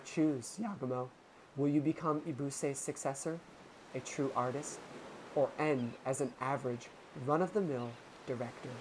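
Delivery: low, soft, condescending purr; low villainess voice. Acted. To Yagumo, tempting him: low, soft villain voice. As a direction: tempt him gently from far below.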